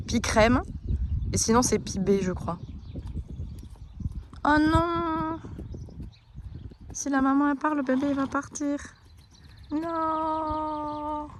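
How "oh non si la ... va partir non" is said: dismayed. Real, not acted. Mare walks away.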